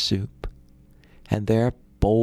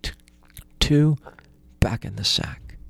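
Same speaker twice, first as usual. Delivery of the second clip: hushed whisper with a false start; whispered command. Acted. Calm command to stop sticks.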